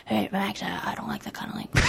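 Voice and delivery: a hint of a soft-spoken dad, quick and half voiceless; mild fatherly scolding, hushed; imitating dad. Dad gently telling Max off, softly hushed. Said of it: "that's my dad".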